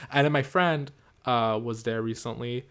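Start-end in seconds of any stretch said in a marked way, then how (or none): none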